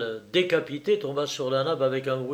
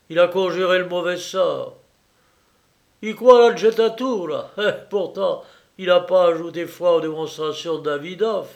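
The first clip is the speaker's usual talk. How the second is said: lightly voiced mocking young man, a little louder; Jacques at the feast; 5 semitones higher, 7 dB louder than usual. Jacques taunting his dinner guests, lightly voiced mockery. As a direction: tease the guests with bitter gaiety.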